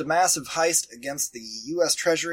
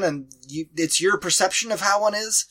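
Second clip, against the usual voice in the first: ordinary talk, nothing marked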